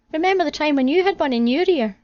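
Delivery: a hint of a playful Adam; lightly voiced Adam. Adam voiced unlike narrator.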